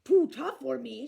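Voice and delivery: loud offended giant; loud indignant giant; indignant shout. A narrator's reading. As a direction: shout back, insulted. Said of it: "shouted Cuchulainn".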